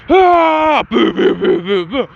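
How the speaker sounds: high yell, then mouthed booms; yell, then fire off a string of vocal blasts; imitated explosion blasts. Hot-headed boy shooting explosions, yell and imitated blasts.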